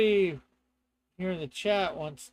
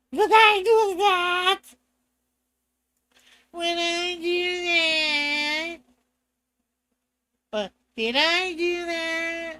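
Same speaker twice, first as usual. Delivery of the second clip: comic character voice; 10 semitones higher, 6 dB louder, much slower than usual. Acted. Comic put-on voice bit.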